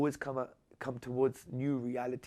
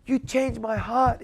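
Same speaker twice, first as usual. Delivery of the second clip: high and slow, choked with feeling; a man moved to tears, imitated; retold tearful thanks; 10 semitones higher, 6 dB louder than usual. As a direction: voice a weeping man's thanks. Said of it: "He said".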